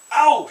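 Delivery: high, loud yelp of ow; mock ow. Goldilocks burning her mouth on porridge, high yelp. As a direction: yelp as if burned. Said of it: "she said".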